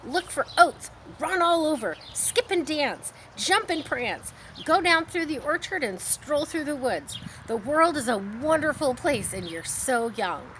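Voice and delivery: high, loud, eager goose voice; breathless goose listing joys; excited goose. Acted. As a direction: sweep him along with delight. Goose urging pig to roam.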